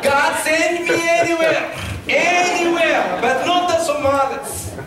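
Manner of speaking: loud, high re-enacted plea; pleading protest. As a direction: cry out in protest to God, playing it up. Re-enacts his protest to God.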